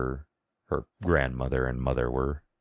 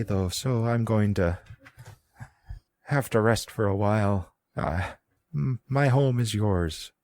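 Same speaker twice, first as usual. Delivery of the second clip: put-on high old woman with an Irish-Scottish twang; high, lilting Irish-Scottish granny; old woman accent; 7 semitones higher than usual. GM voicing the old woman with an Irish-Scottish twang. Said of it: "doing the accent again".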